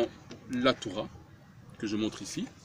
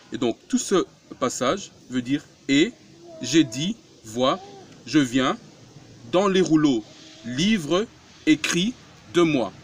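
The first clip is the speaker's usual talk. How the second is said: louder, each word pressed out; preaching emphasis; 3 semitones higher, 4 dB louder than usual. Acted. Preacher driving the verse home to the congregation, louder.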